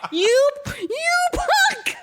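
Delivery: high, drawn-out mock scolding cry; mock outrage. Reader scolding Scott for forgetting his wife, mock-outraged. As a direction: sputter it slowly in exaggerated disgust. Host berates the retold character.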